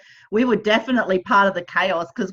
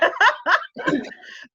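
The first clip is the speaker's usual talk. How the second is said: high burst of laughter; 12 semitones higher than usual. Real, not acted. Friend laughs at her own joke, a high burst of laughter.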